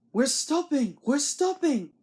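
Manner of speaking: mild call to the trucks. A narrator's reading. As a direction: call out a warning. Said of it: "called Thomas".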